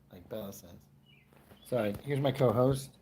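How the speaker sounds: usual speaking voice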